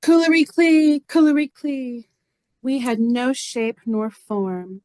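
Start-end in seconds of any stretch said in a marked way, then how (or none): 0.0-2.1 high, loud singsong call. Acted. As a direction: send the call out high, easing on the repeat. Actor calls out invented name.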